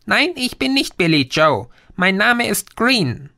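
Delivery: put-on a cyber gnome calming the boy; tiny green creature. Green corrects Raymond. (kleines grünes Wesen, höher, beruhigend)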